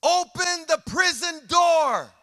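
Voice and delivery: high, slow, ringing cry; climactic shout. Acted. Pastor urging listeners to free themselves, shouted slowly. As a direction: call it out to the back row.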